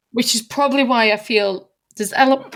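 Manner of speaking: talking through hiccups; hiccuping mid-sentence. Real, not acted. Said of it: "I'm hiccuping, sorry".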